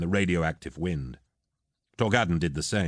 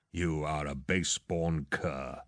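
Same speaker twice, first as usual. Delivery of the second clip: slow, softer hiss of contempt; hissed insult; 3 semitones lower, 6 dB quieter, slower than usual. A narrator's reading. Insulted lord to captain, hissing. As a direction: hiss it slowly with cold venom. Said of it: "Eidolon hissed".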